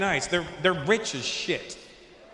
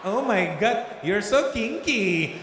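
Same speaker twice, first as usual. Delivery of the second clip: higher, drawn-out coy voice; slow, high, teasing delight; mock turned-on woman; 5 semitones higher, much slower than usual. Woman thrilled by his refusal, mock coy. Woman reacting in retold encounter.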